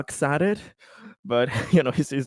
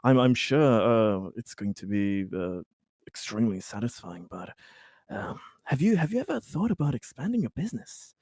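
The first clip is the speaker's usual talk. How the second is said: soft, low, halting businessman voice; hesitant corporate type; 5 semitones lower, 6 dB quieter than usual. Acted. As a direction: feel out a discreet business pitch, haltingly. Plays Conrad, a corporate buyer.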